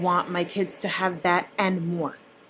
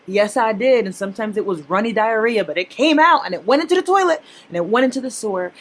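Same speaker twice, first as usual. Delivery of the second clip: loud disgusted rant; 2 semitones higher, 6 dB louder than usual. Real, not acted. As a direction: hammer home how gross the waste was. Gross-out rant about wasted money.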